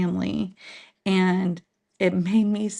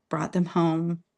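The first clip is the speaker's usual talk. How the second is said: normal talking voice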